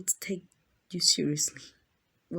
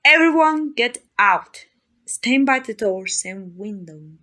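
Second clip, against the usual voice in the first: commanding shout; 7 dB louder than usual. Acted. Vampire clears thralls from room, commanding shout. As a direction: call out a sharp command.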